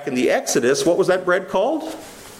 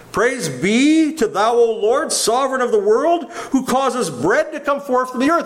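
recited grace; 6 semitones higher than usual. Acted. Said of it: "kinda sounds like a nice grace".

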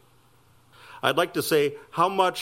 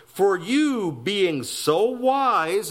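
drawn-out, higher-pitched mock flattery; sarcastic; 5 semitones higher, much slower than usual. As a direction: stretch each word slowly with sarcasm. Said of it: "notices a little sarcastic here".

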